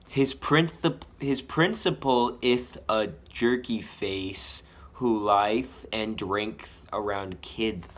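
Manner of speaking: put-on slow child's lisp, with the S sounds turned into TH; a slow lisping kid's voice, ith, lieth, drinkth. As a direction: lisp every S the way a kid with a missing tooth would. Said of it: "I literally wrote the T Hs in to show the lisp".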